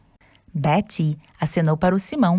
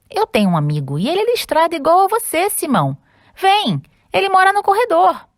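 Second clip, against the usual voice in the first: excited; 11 semitones higher, 3 dB louder, faster than usual. Acted. Ted eager, excited.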